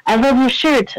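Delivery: a hint of an Irish lilt on the compliment; Irish accent. Voice actor complimenting the fan's shirt in an Irish accent. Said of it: "an amazing Irish accent".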